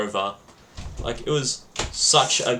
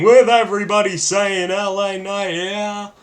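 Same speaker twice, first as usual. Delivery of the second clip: higher, mock crowd shout; imitating a shouting fan; 6 semitones higher than usual. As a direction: belt it out like a fan in the stands. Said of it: "Random people shouting".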